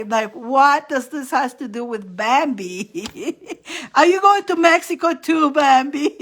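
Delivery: giggling through the question; real amused laughter; laughing. Real, not acted. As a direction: laugh while you ask, high and loud. Laughing at the funny vision.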